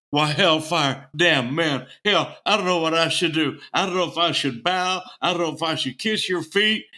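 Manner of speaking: gushing drummer. Retold or imitated. Drummer meeting [name], gushing loudly. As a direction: blurt it out, overwhelmed by a hero.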